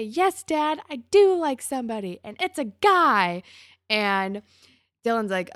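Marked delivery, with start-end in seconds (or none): 0.0-3.4 loud, higher, emphatic declaration; emphatic imagined confession. Retold or imitated. Marco wishing he could tell his dad, emphatic confession. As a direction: proclaim it loudly, as if finally coming out with it.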